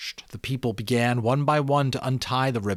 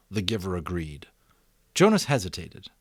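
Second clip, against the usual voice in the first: plain reading voice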